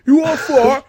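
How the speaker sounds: put-on Cockney hooligan accent. Friend showing the hooligan line in a loud Cockney accent. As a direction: bellow it like a Cockney football hooligan. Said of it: "You gotta say it in a fucking Cockney accent".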